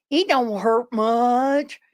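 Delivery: very slow drawl; drawled reply. Retold or imitated. Bitten man shrugging off the bite, very slow drawl. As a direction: stretch out every word. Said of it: "He said".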